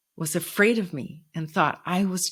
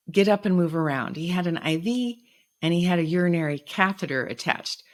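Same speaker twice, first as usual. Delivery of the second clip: usual speaking voice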